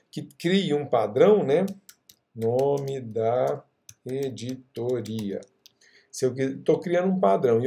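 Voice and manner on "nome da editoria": slow, drawn-out syllables; typing aloud. Real, not acted. Teacher typing a placeholder for the class, slowly. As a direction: say it slowly as you type.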